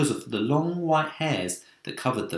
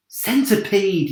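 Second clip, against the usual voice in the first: slow, raised call; yell; 8 semitones higher, 4 dB louder, slower than usual. A narrator's reading. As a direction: call out his name urgently. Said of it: "yelled James".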